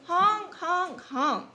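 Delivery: high, loud honking; goose honk. Acted. Teacher honking as the goose for the class.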